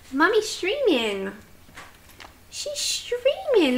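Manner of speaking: high, slow cooing, stretched out; sing-song pet voice, sliding up high; puppy talk. Real, not acted. Explaining to the puppy.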